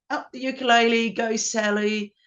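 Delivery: imitating rowdy supporters, half-shouted; retold crowd cheer. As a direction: cheer on the band like fans. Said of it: "they just screamed out loud and went".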